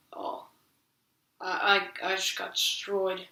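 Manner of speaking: small, quiet, crestfallen mock reaction; softly voiced, halting mock defeat; imitating deflated bully. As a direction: drop your voice as if suddenly beaten. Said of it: "bully's face just totally changed from".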